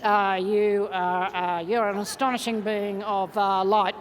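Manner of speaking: half-hearted hesitation. Shown as a demonstration. Coach mocks a reluctant buddy's compliment, hesitant and half-hearted. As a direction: demonstrate how not to say it, unsure and dragging. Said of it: "don't turn to them and do this".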